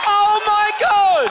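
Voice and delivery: high, slow shout of disbelief; shocked shout. Real, not acted. Host hears guest came from Aberdeen, shouting slow in shock. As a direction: stretch it out, high and loud.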